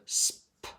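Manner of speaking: "Sp-" as a soft unvoiced breath; sounding out. Shown as a demonstration. Teacher segmenting a base word for students, whispered. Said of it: "Spill is my base word".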